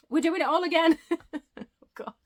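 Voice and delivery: high, loud, giggling at the slip; laughing exasperation. Real, not acted. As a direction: laugh at your own mistake. Slipped up, laughing at herself.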